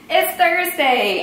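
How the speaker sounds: high, drawn-out announcement; stretched, high-pitched excited call; excited yelling. Real, not acted. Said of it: "Sorry if I'm yelling".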